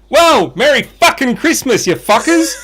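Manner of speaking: high, brash cheer from a swearing parrot; rowdy parrot outburst. Acted. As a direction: blurt it out high and loud as a joke parrot. Joke's parrot pipes up swearing.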